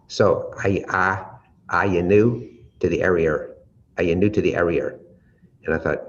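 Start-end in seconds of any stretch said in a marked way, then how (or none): 0.1-5.0 put-on a light Boston accent with an R tacked onto "area"; imitating a Boston accent. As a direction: mimic a Boston local. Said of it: "the way they said it is".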